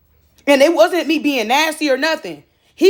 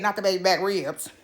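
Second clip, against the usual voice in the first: quoting that lady; 7 semitones lower, 8 dB quieter than usual. Retold or imitated. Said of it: "Like that lady said".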